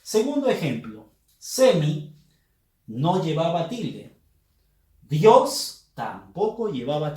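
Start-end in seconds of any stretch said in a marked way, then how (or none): none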